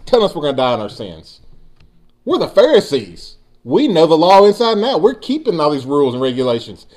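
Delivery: high, indignant scoff at first, then settling; sarcastic Pharisee. Acted. Pharisees scoffing at Jesus, high indignant sarcasm. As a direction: scoff at him, self-righteous. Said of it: "the way I hear this being said".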